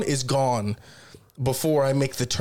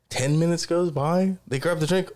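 usual speaking voice

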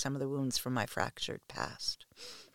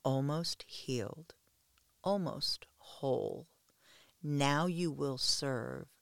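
god's voice; slower than usual. Acted. Thor speaking to the kneeling worshipper, slow otherworldly whisper. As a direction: whisper it slowly like a god speaking in her mind. Thor's thunder rings in my ears.

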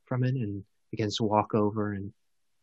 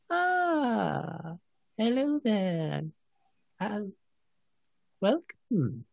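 put-on high, slow old-lady welcome; creaky-sweet crone greeting strangers; kindly old cave woman; 9 semitones higher, 4 dB quieter, much slower than usual. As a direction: draw out a high, soft greeting. Game master plays old lady.

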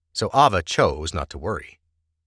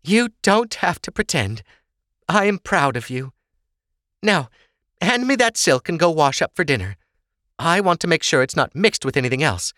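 high-pitched motherly voice; kindly mother; 9 semitones higher than usual. Acted. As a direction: praise your daughter, then send her off. Sorsha praises and instructs Ava.

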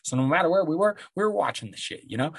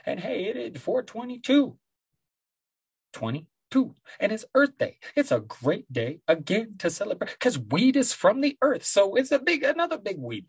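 excited; 9 semitones higher than usual. Real, not acted. Hyped up about weed day.

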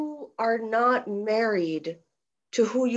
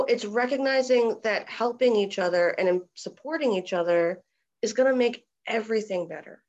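ordinary talk, nothing marked

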